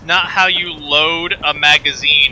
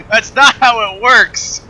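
laughing; 8 semitones higher than usual. Real, not acted. Laughing at broken gun animation.

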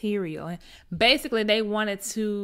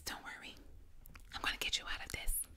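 soft conspiratorial whisper; whispered promise; 15 dB quieter, faster than usual. Retold or imitated. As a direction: whisper it close, secret reassurance. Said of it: "guiding him to class and says".

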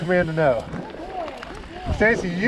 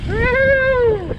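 excited whoop; 4 dB louder than usual. Real, not acted. Cheering dog on.

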